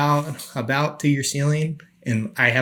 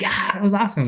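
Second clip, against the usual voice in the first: high, pleased retelling; stoked exclamation; 9 semitones higher than usual. Retold or imitated. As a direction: act thrilled about it. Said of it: "I was like".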